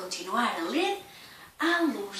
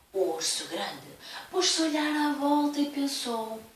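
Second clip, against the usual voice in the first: ordinary delivery, nothing marked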